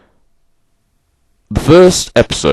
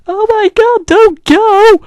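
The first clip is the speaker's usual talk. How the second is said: falsetto, much higher pleading; hammed-up fan begging it not to end; mocking whiny fan; 5 dB louder, slower than usual. Mocking fans as the show leaves, in a high falsetto plea.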